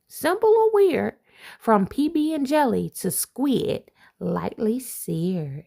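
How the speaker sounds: lively picture-book read-aloud; reading to children. Acted. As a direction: read it like a bedtime rhyme to kids. Reads rhyming picture book aloud.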